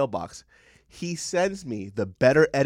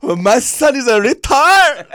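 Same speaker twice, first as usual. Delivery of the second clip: high, loud, slow caricature; mocking mom; 11 semitones higher, 12 dB louder, slower than usual. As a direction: drag it out in a shrill parody.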